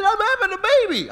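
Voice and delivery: high, fast, loud outburst; wife's panicked cry. Retold or imitated. Wife tells husband the baby is coming, high loud cry. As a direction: blurt it out high and urgent.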